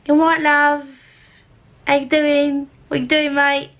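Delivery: high mock Cockney accent; funny accent. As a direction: imitate a coworker's cheery, silly accent. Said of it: "talk to me in a funny accent".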